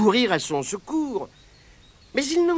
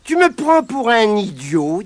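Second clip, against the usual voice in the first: put-on higher, louder boyish voice; boy's retort; 5 semitones higher, 8 dB louder than usual. Pat scoffs at the leprechaun, higher and louder. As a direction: scoff at the trickster.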